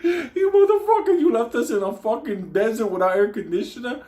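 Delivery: squealing mock fury, laughing through it; laughing outraged yell. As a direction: yell at him while cracking up. Yelling at Ramon, laughing.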